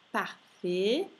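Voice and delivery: "parfait" ending in an E sound, silent T. Shown as a demonstration. Teacher models French diphthong, AI as "e". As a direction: say "parfait" as par-fe. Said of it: "A I si pronuncia E".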